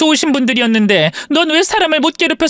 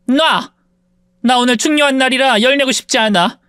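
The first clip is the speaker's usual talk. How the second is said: ordinary delivery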